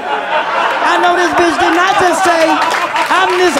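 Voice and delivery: can't believe what she just heard; stunned disbelief. Retold or imitated. Daughter staring at the phone in disbelief, high and slow. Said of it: "you look at the phone like".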